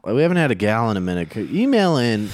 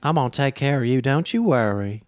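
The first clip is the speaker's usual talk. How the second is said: Georgia debutante; 5 semitones higher than usual. Acted. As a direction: play a sweet Southern lady from Georgia. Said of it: "Can you do a debutante?".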